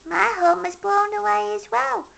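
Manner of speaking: high small hedgehog voice; Little Hedgehog. A narrator's reading. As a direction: sympathetic little creature, high. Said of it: "said Little Hedgehog".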